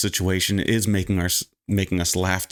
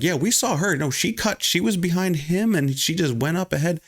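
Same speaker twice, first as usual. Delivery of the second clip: getting louder in the queue; 9 semitones higher than usual. Retold or imitated. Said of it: "We were just starting to get louder".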